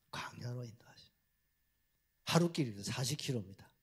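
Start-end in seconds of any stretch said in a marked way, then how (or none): 0.1-1.1 same soft unvoiced whisper; repeated whisper. Real, not acted. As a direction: whisper it once more. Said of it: "whispered answer".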